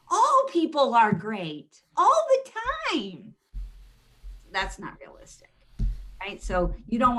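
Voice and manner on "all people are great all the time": mock rosy optimism. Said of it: "Because everybody's amazing".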